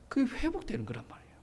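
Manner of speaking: hushed, breathy, intimate urging; urgent whisper. Real, not acted. Preacher whispering to the congregation to drop old words. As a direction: lean in and whisper the warning.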